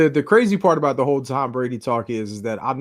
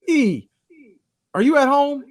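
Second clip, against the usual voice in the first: imitating his friend; 10 semitones higher, 3 dB louder, slower than usual. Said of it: "This joker goes like this".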